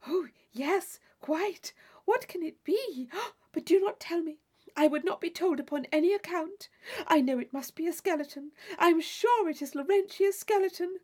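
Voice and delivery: bursting to know but refusing; excited Catherine. Acted. Catherine guessing the secret.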